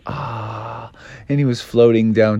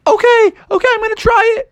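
high frog voice; faster than usual. Acted. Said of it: "Froggy was like".

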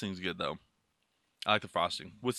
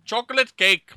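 higher put-on Irish accent; mock Irish accent; 5 semitones higher, 3 dB louder than usual. Man names cake from Ireland in mock Irish. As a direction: announce it in a hammed-up brogue. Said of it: "from Ireland".

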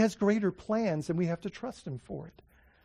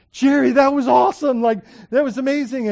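loud, raised retold cheer; elated exclamation; 6 semitones higher, 9 dB louder than usual. Preacher telling Jerry outside about the prayer, elated. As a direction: burst out with delight, pitched up.

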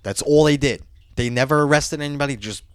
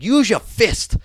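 imitating an irritated dad, raised and punchy; mock-angry order, pitched up; 8 semitones higher, 4 dB louder, slower than usual. Cop dad ordering his nervous son, imitated anger. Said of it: "looks at me like he's angry".

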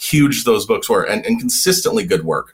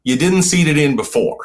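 sarcastic retort; 5 semitones higher than usual. Retold or imitated. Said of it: "you're like".

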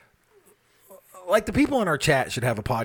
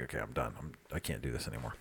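much softer, lower, worn-out sigh of a voice; weary giving up; 12 semitones lower, 15 dB quieter, faster than usual. Retold or imitated. Telling listeners about email overload, low and exhausted. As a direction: drop it low like you're fed up and done. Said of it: "then I'm just like".

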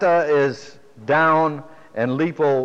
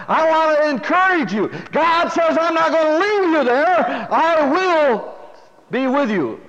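raised, ringing preacher's voice; preaching at full force; 12 semitones higher, 4 dB louder than usual. Acted. Preacher to his congregation, fervent preaching. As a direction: proclaim it to the congregation.

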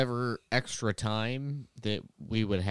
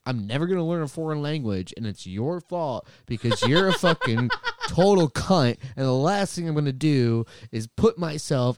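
retold rant; 5 semitones higher, 7 dB louder than usual. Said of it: "I was like".

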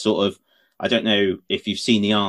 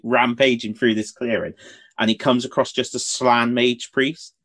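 usual speaking voice

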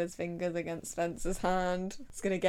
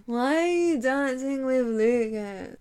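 put-on loud, slow drunken protest; slurred, drawn-out wail, much louder than usual; drunk Emily; 3 semitones higher, 9 dB louder than usual. Emily comes right back at her friend, drunk and loud. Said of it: "Whenever she's drunk".

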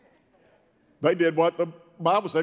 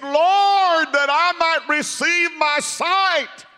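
high, loud cry with stretched words; shouted plea; 12 semitones higher, 6 dB louder, slower than usual. Acted. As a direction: imitate a blind man crying out his request. Blind man's acted plea to Jesus.

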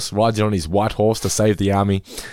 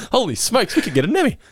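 excited exclaim; 13 semitones higher than usual. Retold or imitated. Quoting Jimbo.

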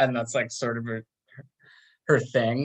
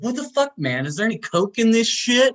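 high-pitched, incredulous complaint; mock outrage rising at the end; coked-up indignation; 10 semitones higher, 4 dB louder, faster than usual. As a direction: imitate an outraged buyer, voice jumping up. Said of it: "even more clearly coked up".

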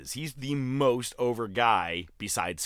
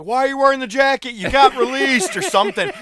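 mock heckle; 13 semitones higher, 11 dB louder than usual. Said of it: "Please tell me someone's like".

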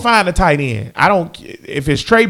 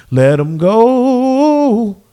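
slow, louder dismissal; 5 semitones higher, 4 dB louder, much slower than usual. Real, not acted. Fan sending the kicker off, slow and emphatic. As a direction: let him go stretched out, a bit higher and louder.

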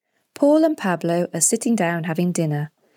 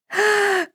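excited gasp. A narrator's reading. Pablo hears of surprises.

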